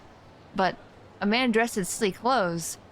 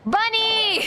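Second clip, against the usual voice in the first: surprised gasp; 10 semitones higher than usual. Real, not acted. Gasps on seeing a bunny.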